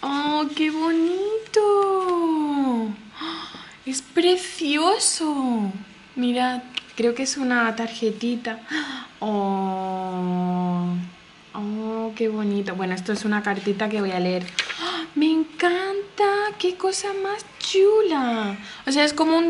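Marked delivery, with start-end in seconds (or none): 0.0-5.7 very slow, drawn-out delight; adoring gush. Real, not acted. Admiring a follower's handmade gift, slow adoring gush. 14.8-18.6 high, drawn-out gush; thrilled delight. Real, not acted. Discovering the card folds out, thrilled high gush.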